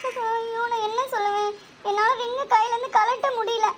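high, soft weepy helplessness; tearful. Acted. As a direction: wail softly as if close to tears. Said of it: "ஏன் அழுகுற". (அழுகையுடன், மெல்லிய உயர்ந்த குரலில்)

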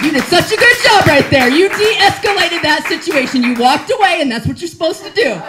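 high, loud mock pep talk to herself; congratulating herself for walking away, gushing; self-praise. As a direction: cheer yourself on like a proud coach.

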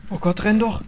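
ordinary delivery

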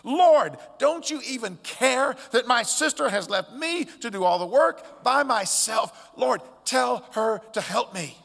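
loud, high-pitched, exasperated plea; acted outburst of a fed-up, overwhelmed sister; frustrated outburst. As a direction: erupt in anxious frustration, hammering the stressed words. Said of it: "erupts in this uncontrollable outburst of frustration and anxiety".

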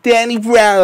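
nasal, drawn-out rapper voice; nasally. Shown as a demonstration. Showing friends a rap voice, nasally and drawn out. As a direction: squeeze it slowly through the nose. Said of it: "the nasally kind of".

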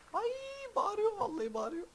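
very high, squealing panic; frightened shriek. Real, not acted. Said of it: "vallahi bağırdı".

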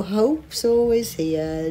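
slow uplifting line. Acted. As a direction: draw it out gently. Slow, high after chant.

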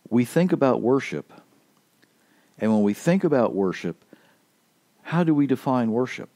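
ordinary talk

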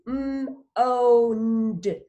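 "m, o, n, d" said one sound at a time. Shown as a demonstration. Teacher showing the sounds in moaned, sounded out. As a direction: break the word into "m", "o", "n", "d". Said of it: "How many sounds do you hear".

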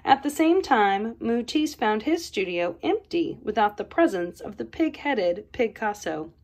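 usual speaking voice

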